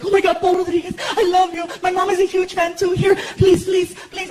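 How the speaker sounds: high-pitched imitation of a starstruck girl; gushing female fan. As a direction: gush and plead in a girlish high voice. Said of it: "this girl jumped into the car".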